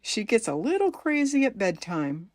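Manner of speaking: ordinary talk, nothing marked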